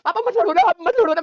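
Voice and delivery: put-on high, loud tearful child pleading; mock sobbing plea to stop the fight; imitating a crying child. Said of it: "अपनी tension में अलग रो रहा हूं". (रोते बच्चे की नकल, ऊँची आवाज़ में गिड़गिड़ाना)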